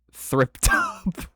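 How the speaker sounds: high, laughing stumble on the words; real laugh breaking into the words; laughing at a slip. Real, not acted. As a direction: crack up over the misread word. Laughs at own misreading.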